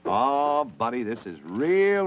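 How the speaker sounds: usual speaking voice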